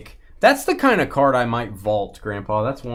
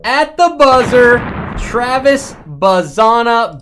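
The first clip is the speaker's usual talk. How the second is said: loud, high and slow, drawn-out call; stretched, shouted announcer call; buzzer-beater hype; 9 semitones higher, 9 dB louder, slower than usual. Real, not acted. Hype call of a big pull.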